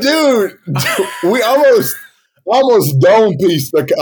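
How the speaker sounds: telling friends the near miss, excited and loud. Real, not acted. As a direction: blurt it out worked up. Reacting to nearly shooting husky.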